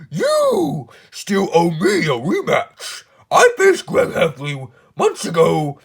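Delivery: a spoiled royal demanding his rematch; whiny King. Acted. King barges into the office, whining for a rematch. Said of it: "it's me, the King".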